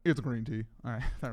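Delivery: low flat mock Shadow. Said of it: "Let me try to do my Shadow voice better".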